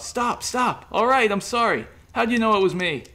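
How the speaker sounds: high, loud, choked begging; loud high panicked plea; choking plea. A narrator's reading. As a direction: gasp it out after being strangled. Choked man begging to stop.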